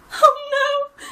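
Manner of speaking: high, loud, tearful cry. Acted. Gran crying out at the piglets' threat, tearful. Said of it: "cried Gran".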